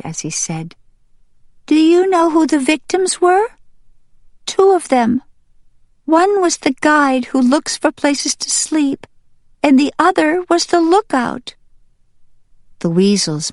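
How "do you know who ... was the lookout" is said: put-on high, louder child-character voice; young duck Green Top, agitated. Young duck tells mother who the victims were, agitated. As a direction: break startling news in a high young voice.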